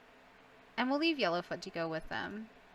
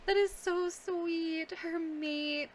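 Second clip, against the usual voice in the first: cooing; 7 semitones higher, much slower than usual. Real, not acted. Cooing over comforted cat.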